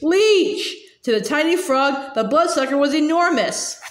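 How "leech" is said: high, frightened cry of leech; shriek. A narrator's reading. Frog prince spotting a leech, frightened shriek. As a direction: yelp at the bug on your leg.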